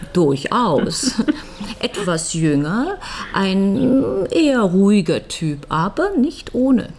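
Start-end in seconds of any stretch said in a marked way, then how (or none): 1.2-1.8 brief quiet chuckle; soft laugh. Acted. Widow chuckles while describing the younger lawyer. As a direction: let a little laugh slip. Amused by question about August.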